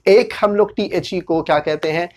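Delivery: ordinary delivery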